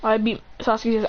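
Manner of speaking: plain reading voice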